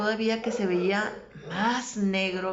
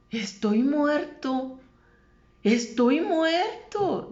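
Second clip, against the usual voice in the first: grito alargado, agudo y fuerte; gritos retelling; 9 semitones higher, 8 dB louder, much slower than usual. Retold or imitated. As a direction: cry it out slowly, high and loud, reliving his panic. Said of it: "dice que gritaba". (grito desesperado, imitándolo)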